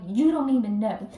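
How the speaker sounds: loud, exasperated mock outburst; hammed-up glare in the voice; retold indignation. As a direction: snap it as an acted angry thought. Acts her furious thought, glaring.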